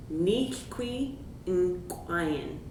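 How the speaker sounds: "Nichqui in crying" said very slowly, each sound apart; slow teaching demo of "Nichqui in crying"; S'Klallam phrase. Shown as a demonstration. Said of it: "Try to repeat after me".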